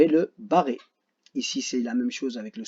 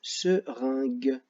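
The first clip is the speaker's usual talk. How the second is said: seringue drawn out slowly; slow syllable demo; much slower than usual. Shown as a demonstration. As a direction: say seringue slowly so they can write it. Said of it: "vous devez écrire le mot seringue". (seringue prononcé lentement)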